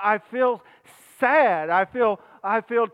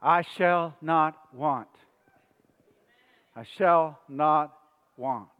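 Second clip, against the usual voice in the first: slow, low, weighty words; solemn scripture recitation; 5 semitones lower, 3 dB quieter, slower than usual. Real, not acted. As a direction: let each word settle, unhurried. Solemn repeated scripture line.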